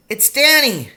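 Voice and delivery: high, loud call; shouting. A narrator's reading. Boy tells his lost father who is calling, shouting. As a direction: holler it into the distance. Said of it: "I shouted".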